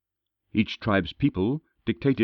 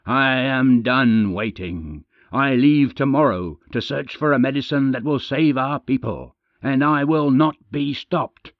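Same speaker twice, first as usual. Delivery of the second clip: angry vow; 4 semitones higher, 5 dB louder than usual. Acted. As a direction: spit out each word, unstoppable.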